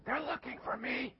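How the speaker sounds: put-on high breathy whisper of a small child. Little Jimmy whispering his name to the caller, high and hushed. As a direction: whisper your name like a hiding kid. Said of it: "And little Jimmy keeps whispering".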